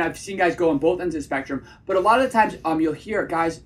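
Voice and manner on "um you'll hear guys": ordinary delivery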